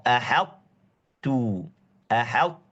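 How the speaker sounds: "Ahattu" very slow, t held without release; drawn-out "Ahattu" without qalqalah; no bounce. Shown as a demonstration. Teacher modelling the correct reading, very slowly. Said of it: "Jangan mantul".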